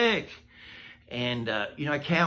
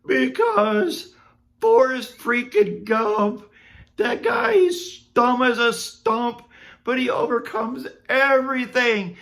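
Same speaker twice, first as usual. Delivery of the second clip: high, loud mock-crying rant; hammed-up sobbing pity-party rant; blubbering while crying; 10 semitones higher, 5 dB louder than usual. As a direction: wail it out like an overgrown crybaby. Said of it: "I couldn't talk for a minute".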